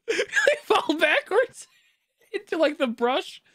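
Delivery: words broken up by real laughter; can barely get it out for laughing; laughing through words. Real, not acted. Laughing while telling the fall.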